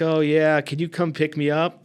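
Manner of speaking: put-on breezy old man asking for a ride; mock-casual old man asking to be picked up from jail; imitating Roger.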